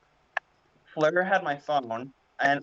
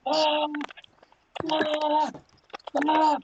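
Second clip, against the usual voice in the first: high mock screams of pain; repeated high yells after face-planting; retold scream; 13 semitones higher, much slower than usual. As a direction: shriek three times like a fall on the stairs. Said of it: "started screaming".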